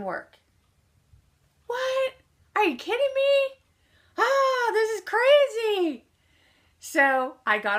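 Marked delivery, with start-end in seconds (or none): 1.7-6.1 high, loud outburst of disbelief; stunned high-pitched are-you-kidding-me; shocked disbelief. Retold or imitated. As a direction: squeal it like you can't believe it. Key fails again, disbelief.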